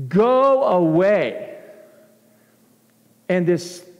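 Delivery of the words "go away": a slow, stretched, louder command; mock yell. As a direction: drag out "go away" like an order to the storm. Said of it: "he yells it".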